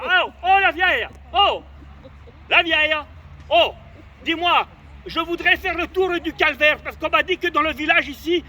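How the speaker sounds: loud, high shout across the square; calling out. Retold or imitated. Hammed-up call across the village to the old woman. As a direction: hail her from far off.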